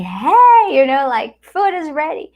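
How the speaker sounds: high, loud call that food is ready; shouting to husband. Retold or imitated. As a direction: yell it across the house. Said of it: "I was shouting at him".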